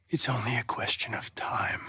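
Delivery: low, hushed whisper; a quiet whispered vow. Acted. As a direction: breathe it out under the breath. Private certainty, whispered.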